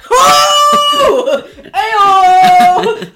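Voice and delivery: high, loud whooping cheer; cheering shout. Real, not acted. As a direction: whoop it up like a fan. Cheering Scarpetta forever.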